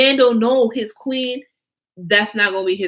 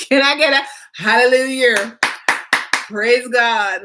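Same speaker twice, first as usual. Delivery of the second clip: laughing call; 4 semitones higher than usual. Real, not acted. Laughing preacher-style call out.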